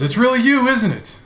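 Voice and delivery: high, loud amazed question; astonished servant. Acted. Elderly dragonborn amazed to see the young master. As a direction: marvel that it's really him. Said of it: "He says".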